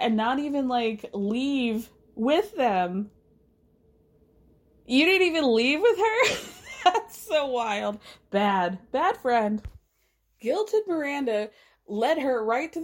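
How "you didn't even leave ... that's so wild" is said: can't believe it, laugh through; laughing disbelief. Real, not acted. Podcaster amazed at Carrie leaving Miranda, laughing.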